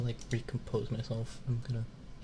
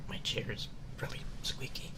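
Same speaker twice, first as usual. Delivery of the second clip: whispering; 7 dB quieter than usual. Real, not acted. Whispered apology.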